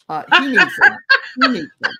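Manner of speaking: high, loud laughing speech. Real, not acted. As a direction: crack up while talking. Laughing over the pretend dosage joke.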